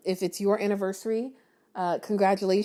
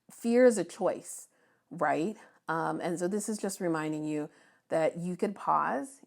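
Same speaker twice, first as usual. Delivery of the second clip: normal talking voice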